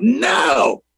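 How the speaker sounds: high-pitched hollered imitation of a defiant toddler; small child's defiant yell, imitated; hollered refusal. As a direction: holler it out like a stubborn three-year-old. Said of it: "he'd holler out".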